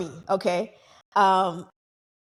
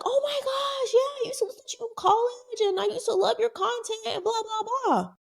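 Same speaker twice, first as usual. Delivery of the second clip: very high, breathless gushing, trailing into blah blah; gushing fan. Retold or imitated. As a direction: squeal it like a fan meeting her idol. Fan gushing about her content.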